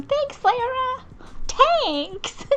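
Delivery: very high, drawn-out thanks; squeaky-sweet thank you for a compliment; high, slow, delighted thanks; gushing thanks. Real, not acted.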